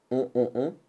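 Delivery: on on on, nasal on repeated; sound drill. Shown as a demonstration. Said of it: "O et N font le son".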